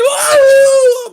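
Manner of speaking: high, loud fake yelp of pain; mock cry of pain. Kid hit by dad, loud wailing yelp. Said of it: "the first hit".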